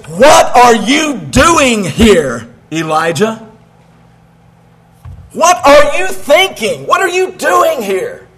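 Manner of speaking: slow, probing voice of God calling out; God challenging Elijah, slow and searching. Acted. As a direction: stretch each word as God confronting the prophet. God's voice questioning Elijah.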